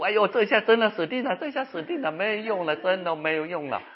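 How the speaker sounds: high-pitched mock crying lament; tearful despair. Preacher imitates patient's phone call about dying, tearful despair. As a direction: wail it as a hopeless patient would. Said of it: "人家打电话就哭".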